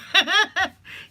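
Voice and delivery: loud high-pitched burst of laughter; bright squealing laugh. Real, not acted. Laughs at own carrot joke.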